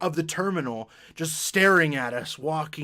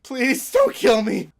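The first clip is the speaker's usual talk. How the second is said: mock-frightened plea, high and loud; mock terror; 6 semitones higher, 7 dB louder, slower than usual. Kid begging the angry man, mock frightened. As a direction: beg for your life, hammed up. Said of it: "and I'm just".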